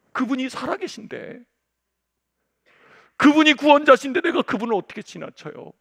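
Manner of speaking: ordinary talk, nothing marked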